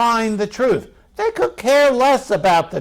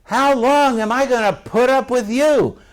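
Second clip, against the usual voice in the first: higher-pitched imitation of a fed-up parent; mock parental exasperation; exasperated parent; 4 semitones higher than usual. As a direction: imitate a parent at wit's end. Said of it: "my mom and dad, you know, had that memorized".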